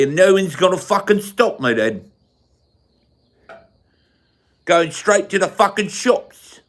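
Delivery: high, hammed-up London accent; exaggerated London accent, high and defiant; mock London accent. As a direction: play an indignant Londoner, swooping up in pitch. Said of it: "We talk like this".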